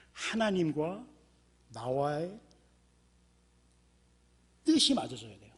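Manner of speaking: usual speaking voice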